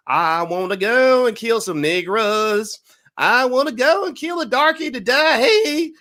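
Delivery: high, loud, slow mock country crooner; slow, high, hammed-up hillbilly singing voice; country singer caricature. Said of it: "he was like".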